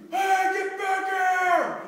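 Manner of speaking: long, loud high-pitched yell; slow, drawn-out commanding shout; calling after runners. Acted. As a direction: holler it down the street. Acted man yelling after runners.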